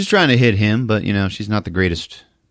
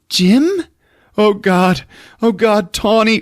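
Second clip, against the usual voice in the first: high, loud distressed cries; mock horrified realization; 13 semitones higher, 5 dB louder, slower than usual. Girlfriend snapping back to herself, mock horror. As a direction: come to, horrified. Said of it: "She's like".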